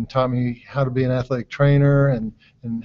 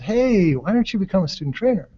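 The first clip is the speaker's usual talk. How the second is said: higher-pitched quote of a coach; coach's eager suggestion; 7 semitones higher than usual. Retold or imitated. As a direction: imitate a coach pitching a bright idea.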